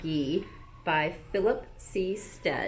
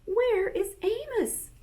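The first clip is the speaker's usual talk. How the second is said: much higher, slow creature voice; worried animal voice; 14 semitones higher, slower than usual. Acted. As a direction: ask like a fretful zoo animal. Animals wonder together.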